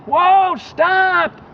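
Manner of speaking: high, loud cry to halt; a loud high whoa and stop at a passing car; shouting stop. Retold or imitated. Man waving lantern at car.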